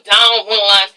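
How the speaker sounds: "Don Juan" drawn out slowly; affected, lingering "Don Juan"; put-on name pronunciation. Real, not acted. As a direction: stretch "Don Juan" in a non-English accent. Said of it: "why I say his name like that".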